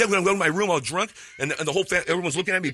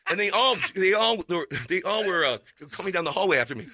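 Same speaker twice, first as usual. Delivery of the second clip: ordinary talk, nothing marked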